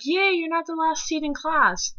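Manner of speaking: high mock-cheery teacher voice; imitating a teacher's fake praise. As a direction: chirp it like a fake-nice teacher. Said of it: "she's like".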